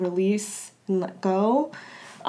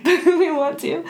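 laughing through tears; 8 semitones higher, 4 dB louder than usual. Real, not acted. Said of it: "I'm not gonna cry".

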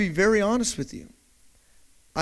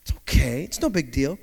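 softer, reassuring self-talk; calming himself down; 5 semitones lower, 6 dB quieter than usual. Retold or imitated. Preacher reassuring himself about stolen goggles, soft and calm. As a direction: talk yourself down gently. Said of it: "I snapped out of it".

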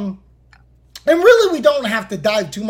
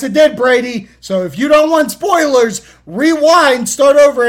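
loud; 4 semitones higher, 8 dB louder than usual. Acted. Spoiler warning called out.

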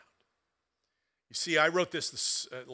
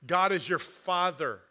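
slow and louder, rising toward the end; building preaching; 3 semitones higher, 4 dB louder than usual. Acted. Pastor reassuring the church of God's love, slow and building. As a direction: press each promise home.

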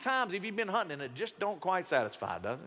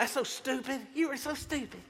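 high-pitched mocking voice of disapproval; scornful critic; 6 semitones higher than usual. Critics judging a grown gamer, mock scornful. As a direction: scold in a high, put-on voice.

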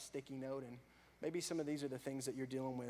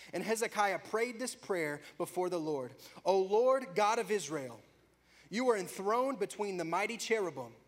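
louder, higher dramatic reading; scripture reading; 6 semitones higher, 9 dB louder than usual. Acted. Preacher reading Hezekiah's prayer aloud, dramatic. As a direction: read the prayer with fervour.

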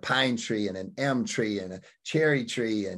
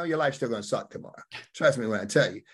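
ordinary talk, nothing marked

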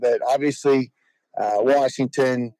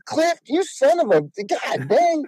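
much higher, hammed-up frustrated cry; mock exasperated outburst; 11 semitones higher than usual. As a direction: throw up your hands at your buddy, half-joking. Retold outburst at Cliff.

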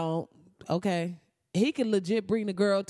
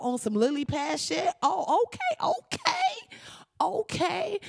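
sarcastic outrage; 7 semitones higher than usual. Real, not acted. Ranting at Disney, sarcastic and high. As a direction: sneer it with rising disbelief.

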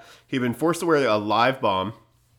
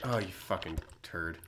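muttering; 3 semitones lower, 13 dB quieter than usual. Real, not acted. Muttering insult at partner's ad.

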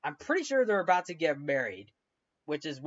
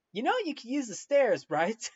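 lightly higher, teasing; dry Emily quip; 7 semitones higher than usual. Retold or imitated. Emily looking down at Victor climbing, teasing. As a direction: tease him with a deadpan question. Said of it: "Emily looks down ... is like".

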